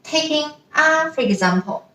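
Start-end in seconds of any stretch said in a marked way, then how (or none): 0.7-1.1 ah held high and level; first tone ah. Shown as a demonstration. Teacher showing the flat first tone on ah. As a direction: keep ah high and steady. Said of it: "the pronunciation is also flat".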